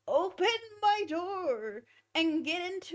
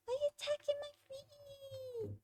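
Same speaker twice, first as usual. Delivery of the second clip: soft, much higher pet voice; 10 semitones higher, 7 dB quieter than usual. Real, not acted. Scolding Mango for biting her feet, high soft baby talk. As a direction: coo at the cat in a tiny voice.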